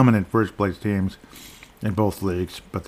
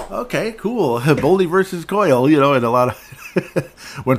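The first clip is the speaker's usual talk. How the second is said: laughing excitement; 7 semitones higher, 4 dB louder, slower than usual. Real, not acted. Laughs at the faceoff matchup.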